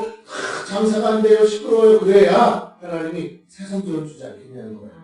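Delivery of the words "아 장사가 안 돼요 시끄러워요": complaining neighbour. Acted. Voices neighbours complaining about loud prayer.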